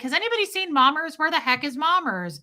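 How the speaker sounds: high, fast, animated searching call; playful calling out. Retold or imitated. Streamer retelling her search for a regular, playful. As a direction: re-enact asking the room where a friend went.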